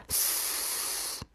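long soft hiss of a sleeping snake; snoring snake hiss. A narrator's reading. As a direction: let out a quiet, drawn-out hiss. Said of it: "znělo stejně jako s". (spící had tiše syčí)

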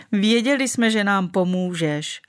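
ordinary delivery, nothing marked

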